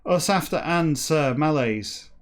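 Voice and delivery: slow, deliberate read-aloud; story narration. Acted. Reader reciting a backwards whale novel to listeners, measured narration. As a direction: read each phrase out with measured emphasis.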